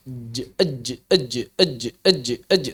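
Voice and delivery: ej with hard contact; jim demo. Shown as a demonstration. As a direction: press tongue and release ej. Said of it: "demo of jim". (contoh jim)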